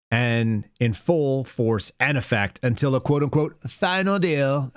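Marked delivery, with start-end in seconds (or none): 3.7-4.7 "final deal" drawn out slow and higher, in audible air quotes; sarcastic air-quoted final deal. Shown as a demonstration. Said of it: "in air quotes".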